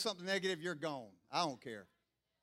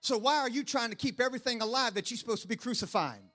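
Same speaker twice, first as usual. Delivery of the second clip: ordinary talk, nothing marked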